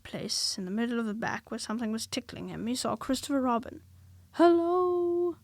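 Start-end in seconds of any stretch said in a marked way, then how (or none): 4.3-5.4 higher, louder joyful Hello!; joyful shout. A narrator's reading. Pooh greeting Christopher Robin, high joyful shout. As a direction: call out delighted. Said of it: "he shouted joyfully".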